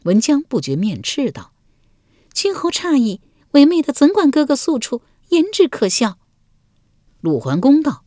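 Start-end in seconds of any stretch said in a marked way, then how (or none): 2.3-6.2 high, indignant denial; flustered consort. A narrator's reading. Wife denying to her husband, high and indignant. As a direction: brush it off as laughable, pitched up high. 文姜不觉面赤道.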